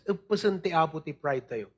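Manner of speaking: usual speaking voice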